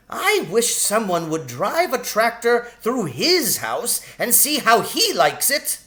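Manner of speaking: a tiny sharp-tongued shrew, pitched high; sharp lady shrew. Acted. Shrew grumbles about farmer.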